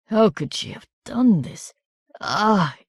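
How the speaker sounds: pained, wandering, bitter wounded voice; a hurt man raving in confusion; delirious wounded man. A narrator's reading.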